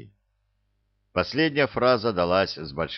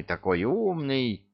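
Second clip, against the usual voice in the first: higher, slower gushing praise; drawn-out flattery; 5 semitones higher than usual. Acted. Luke repeats praise louder.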